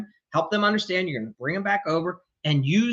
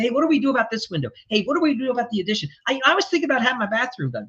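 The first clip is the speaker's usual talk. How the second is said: fast and higher, questions tumbling out; scattered chatty client; 6 semitones higher, much faster than usual. Retold or imitated. Homeowner piling projects on the contractor, fast chatty voice. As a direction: rattle off every new project idea. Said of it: "They're gonna say".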